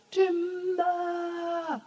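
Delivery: loud drawn-out call of timber; lumberjack's shouted warning. Acted. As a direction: shout it across the forest like a woodcutter. Lumberjack calling out.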